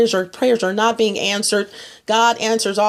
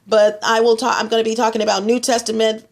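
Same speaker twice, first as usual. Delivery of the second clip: usual speaking voice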